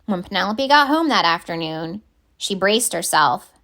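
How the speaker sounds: ordinary delivery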